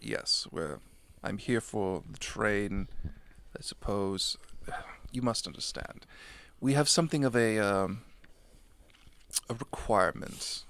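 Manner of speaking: low, soft, halting demon voice; contract demon. Acted. Demon excusing himself to the players, halting and low.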